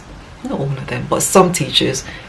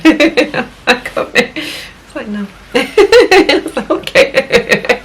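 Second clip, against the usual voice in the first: laughing through the words, pitched much higher; 11 semitones higher, much slower than usual. Real, not acted. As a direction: giggle helplessly while speaking. Laughing about her son's stubbornness.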